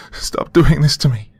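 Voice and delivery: high, loud pained plea; anguished cry. Acted. The broken girl begs the creature inside, high anguished cry. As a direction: cry out in pain and terror. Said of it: "I cried".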